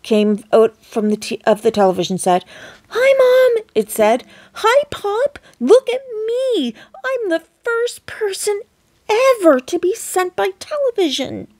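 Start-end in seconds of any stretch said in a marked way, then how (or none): 2.9-11.5 put-on much higher tiny boy's voice; tiny squeaky voice. A narrator's reading. Shrunken boy greeting his parents, tiny squeaky voice. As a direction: squeak it like a mouse-sized boy. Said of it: "A tiny voice, no louder than the squeaking".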